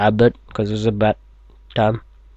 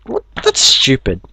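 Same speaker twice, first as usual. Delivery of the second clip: ordinary talk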